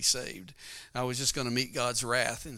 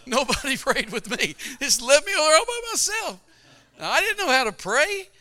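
high, loud plaintive pleading; forlorn; 13 semitones higher, 10 dB louder than usual. Retold or imitated. Preacher recalling being left alone, high plaintive voice.

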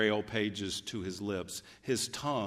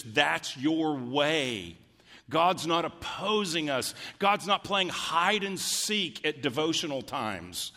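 fervent preaching; 6 semitones higher, 4 dB louder than usual. Acted. Preaching heats up.

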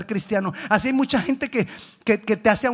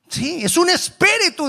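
high-pitched, loud acted alarm; hammed-up fright; frightened disciple; 11 semitones higher, 5 dB louder than usual. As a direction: confirm it in a panicky cry.

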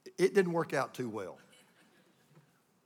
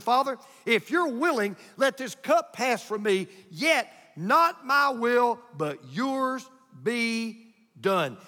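forceful, slow cadence with punched words; emphatic preaching; 4 semitones higher, 7 dB louder than usual. Acted. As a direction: drive each phrase home to the congregation.

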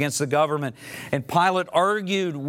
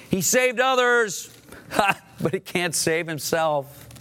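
mocking jeer; 6 semitones higher than usual. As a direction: sneer at him, laughing at his helplessness. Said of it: "Religious leaders shouted to the onlookers".

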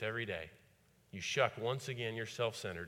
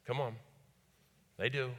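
ordinary talk, nothing marked

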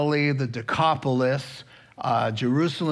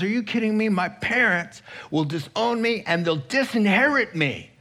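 put-on protesting young man; 7 semitones higher than usual.

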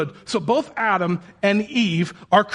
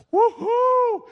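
high-pitched celebratory woohoo; whooping cheer. Acted. Preacher playing Adam reacting to Eve, whooping cheer. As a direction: whoop it high, acting overjoyed. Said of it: "That's what he did".

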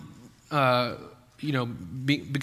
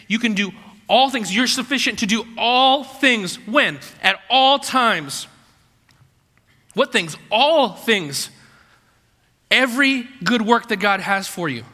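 rising preach; 6 semitones higher, 5 dB louder than usual. Acted. Preaching climax.

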